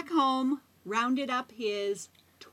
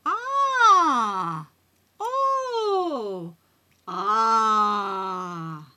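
exaggerated groans; 4 semitones lower, 3 dB louder, much slower than usual. Wise woman reacts.

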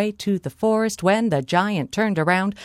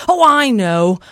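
loud, higher giant's eureka; triumphant giant; 4 semitones higher, 8 dB louder than usual. Acted. Giant thinks he has outwitted Molly, loud triumph. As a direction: pounce loudly on the idea.

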